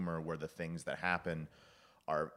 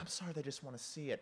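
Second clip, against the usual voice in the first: high, soft imitation of a sorry husband; mock-meek excuse, pitched up softly; sheepish apology; 7 semitones higher, 6 dB quieter than usual. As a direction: play a meek apology, small and hushed.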